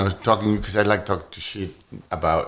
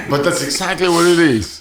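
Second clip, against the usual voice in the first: laughing; 7 semitones higher, 4 dB louder than usual. Real, not acted. Laughing agreement.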